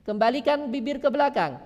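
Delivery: ordinary delivery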